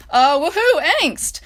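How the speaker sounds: high, loud sarcastic woohoo; mock cheer. Real, not acted. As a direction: cheer ironically. Sarcastic cheer after story.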